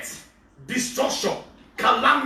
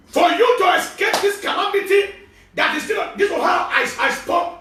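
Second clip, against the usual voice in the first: higher, louder urgent preaching; 7 semitones higher, 3 dB louder than usual. Acted. Pastor urging escape from calamity, loud urgent preaching. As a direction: press the warning.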